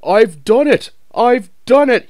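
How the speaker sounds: high, loud panting victory cry; breathless triumph. A narrator's reading. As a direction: gasp it out at the top. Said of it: "he panted".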